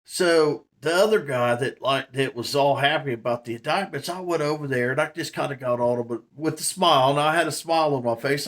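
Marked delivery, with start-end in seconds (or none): none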